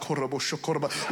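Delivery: lightly voiced speaking in tongues; hushed mock prayer language. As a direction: babble it low as fake tongues. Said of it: "spoken in tongues".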